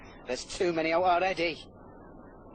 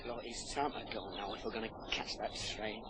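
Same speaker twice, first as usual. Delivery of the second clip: ordinary delivery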